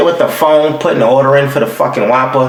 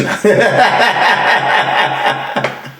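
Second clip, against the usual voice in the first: breathy laugh; 8 semitones higher than usual. Real, not acted. Laughs at his own joke.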